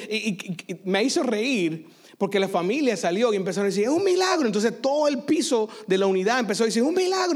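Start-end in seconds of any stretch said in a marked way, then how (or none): 3.9-4.5 exclamación aguda de asombro; grito de milagro imitado. Retold or imitated. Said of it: "Empezaron a gritar". 6.8-7.4 exclamación muy aguda; grito colectivo imitado. Retold or imitated. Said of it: "Empezaron a gritar".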